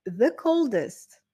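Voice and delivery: "the coldest" said slowly, both words leaned on; slow down and hit "the coldest"; stressed superlative. Shown as a demonstration. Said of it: "Donc, ça nous donne".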